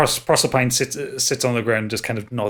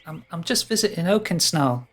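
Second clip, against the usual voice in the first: put-on high boyish satyr voice; 6 semitones higher than usual. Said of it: "turns to look at the tree and says".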